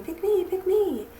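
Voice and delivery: high, lightly voiced begging gourd; a hint of a tiny pleading gourd; little gourd voice. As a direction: squeak out a greedy plea. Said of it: "the one that was saying".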